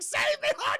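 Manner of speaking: high mock scream for help; hammed-up terrified shriek. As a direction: shriek like you're being dragged away. [name] dragged to the cellar.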